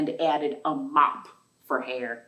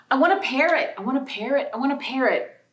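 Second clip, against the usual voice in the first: put-on higher, louder whiny child chanting wants; demanding little girl; 7 semitones higher, 5 dB louder, faster than usual. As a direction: nag like a little girl who wants a pet. Child character repeating demands.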